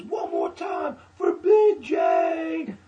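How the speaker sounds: high, drawn-out announcer yell; shouted introduction. Retold or imitated. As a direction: imitate an MC roaring out a name, slowly. Retold host shouting the introduction.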